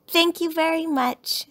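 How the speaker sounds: put-on high, loud child's thanks; lightly voiced pleased cub; grateful cub. A narrator's reading. Sister gets her teddy back.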